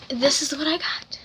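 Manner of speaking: hushed whisper. Real, not acted. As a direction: whisper it softly. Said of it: "whisper heard".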